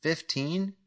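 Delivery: rising, unsure echo of the number; surprised question. A narrator's reading. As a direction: ask it back in disbelief. Jack surprised by the offer.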